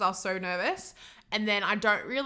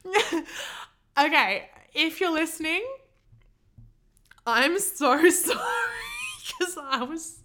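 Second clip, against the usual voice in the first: higher voice through laughter; laughing; 7 semitones higher than usual. Real, not acted. Laughing while apologising again to the date. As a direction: still giggling while trying to talk.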